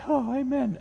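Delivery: high, hammed-up relieved "Oh, amen"; mock relieved amen. Pastor mocking his flock's relief, high mock cheer. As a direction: play the listener glad it's short. Congregation imagined relieved short sermon.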